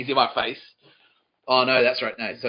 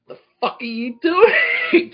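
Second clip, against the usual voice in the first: very high, loud, slow outraged yell; yelling at the guy who trashed my cell; furious shriek; 11 dB louder, much slower than usual. Retold or imitated. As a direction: scream it at him in disbelief.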